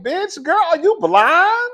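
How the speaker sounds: high, loud, outraged; incredulous retort. Retold or imitated. Scolding the girls who say Mauricio isn't fine, high and loud. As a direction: snap back at someone who can't see it. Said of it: "I be like".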